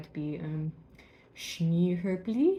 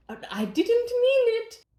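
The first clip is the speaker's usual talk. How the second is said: put-on high, louder, pleading boy; apologetic Peter; 12 semitones higher, 7 dB louder than usual. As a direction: plead innocence to heaven. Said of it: "he opens his hands to show God".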